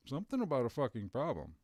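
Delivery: usual speaking voice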